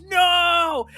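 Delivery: high, loud, drawn-out cry of no; mock movie-hero scream; retold scream. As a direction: cry it out, reenacting a desperate rescue. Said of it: "who just screams".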